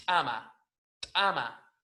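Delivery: "tama" with a popped ejective T; hard glottal click of T in "tama"; explosive T. Shown as a demonstration. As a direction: strike the T of "tama", tiny gap before the vowel. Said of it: "con una T explosiva".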